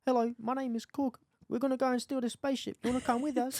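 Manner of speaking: high mock New Zealand accent; Cork. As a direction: pitch it up, mimicking the Avengers character. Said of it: "Aven-, Avengers".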